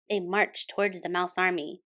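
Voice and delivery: plain reading voice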